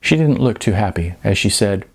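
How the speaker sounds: plain reading voice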